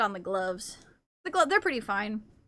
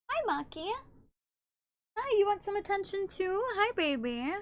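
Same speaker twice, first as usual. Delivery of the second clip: soft cooing; 7 semitones higher, 5 dB quieter than usual. Real, not acted. Soft greeting to cat.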